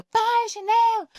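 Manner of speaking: imitating a mother snapping the order; mock yell. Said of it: "Mas aí quando tá mais". (grito de mãe imitado, bem agudo)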